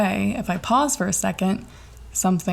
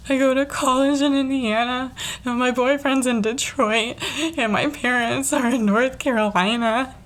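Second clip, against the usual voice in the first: higher, wavering, near tears; shaky teary voice; 5 semitones higher than usual. Retold or imitated. Telling the officer where family lives, shaky and teary. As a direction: hold back tears as you explain. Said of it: "shakiness in my voice".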